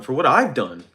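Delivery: sharp, indignant question; defensive outburst. Real, not acted. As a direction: bristle at the accusation. Indignant reply to lawsuit threat.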